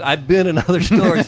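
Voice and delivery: amused, laugh-broken confession; laughing admission. Real, not acted. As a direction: break into a real laugh while confessing. Laughs while confessing to visiting rivals.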